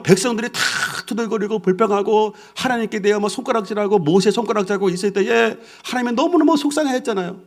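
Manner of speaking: usual speaking voice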